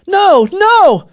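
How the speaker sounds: very high, very loud cry; frantic full-voiced protest; desperate shout. A narrator's reading. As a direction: scream out to stop them. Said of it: "shouted Aaron".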